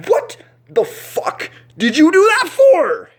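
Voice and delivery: very high, loud, slow shriek of shock; pained outraged yell. Retold or imitated. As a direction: scream it after being punched. Punched in the eye.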